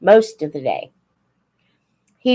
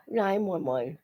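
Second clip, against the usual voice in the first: ordinary talk, nothing marked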